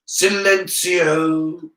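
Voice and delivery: commanding wizard's incantation; incanting a spell. A narrator's reading. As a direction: stretch each syllable of the spell. Teacher casts a silencing spell.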